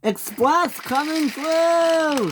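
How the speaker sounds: high, slow, stretched call; drawn-out high shout. Acted. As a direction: announce it proudly down the line. Henry announcing his train.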